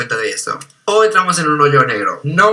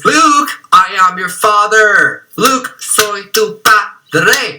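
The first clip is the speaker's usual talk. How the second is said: slow, raised-pitch mock movie reveal; hammed-up villain declaration, every word stretched out; Darth Vader; 4 semitones higher, much slower than usual. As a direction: draw it out like an epic film line. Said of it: "hacen la parodia a esta escena".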